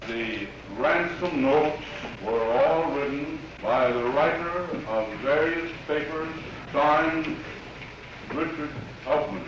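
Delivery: scratchy archival courtroom audio. Real, not acted. Archival courtroom recording clip.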